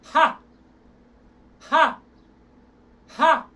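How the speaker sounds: short loud ha from the diaphragm. Shown as a demonstration. Coach shows students a loud pushed ha. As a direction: push out a loud ha. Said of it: "Try ha".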